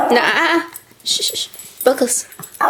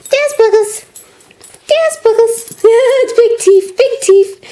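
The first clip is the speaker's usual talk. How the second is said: excited; 7 semitones higher than usual. Real, not acted. Gasp, delighted high greeting.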